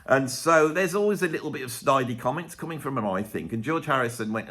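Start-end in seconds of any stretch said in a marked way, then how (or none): none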